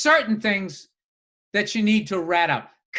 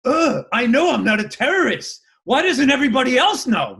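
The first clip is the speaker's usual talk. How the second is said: higher, louder entitled whine; self-mocking whine; 4 semitones higher, 5 dB louder than usual. Mocking himself at airport security, entitled whine. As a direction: whine it like a put-upon diva. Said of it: "just full of myself where it's like".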